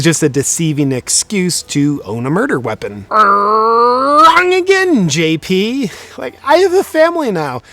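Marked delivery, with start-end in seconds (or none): 3.1-5.9 slow, high, loud singsong; mocking game-show voice. Correcting his old self, slow mock announcer voice. 6.2-7.6 high, louder indignant protest. Acted. Telling his old self off, indignant and high.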